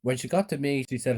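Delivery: ordinary delivery, nothing marked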